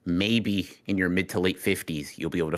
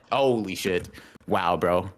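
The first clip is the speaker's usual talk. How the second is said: usual speaking voice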